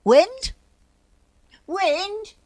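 high, searching calls with rising ends; calling out. A narrator's reading. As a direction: call into the distance for someone. Bear calling out for Wind.

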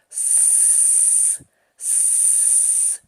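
long hissing pump sound. Shown as a demonstration. Teacher hisses like a pump for the children. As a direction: hiss like a tyre pump. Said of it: "А який звук виходив у насоса".

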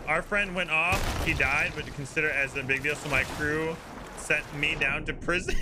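ordinary talk

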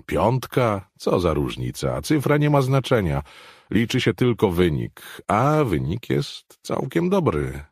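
impatient certainty, lightly characterized; dismissive Brockman. Acted. Brockman dismissing the numbers to Riverdale, lightly voiced impatience.

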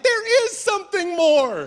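high, wondering, wide-eyed voice; dreamy believer girl. Retold or imitated. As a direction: say it like you truly want to believe. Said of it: "They're like".

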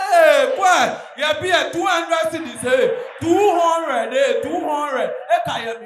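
panicked shouting. Retold or imitated. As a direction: yell at the machine in panic. Woman screaming at locked ATM.

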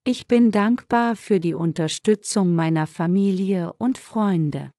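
ordinary delivery

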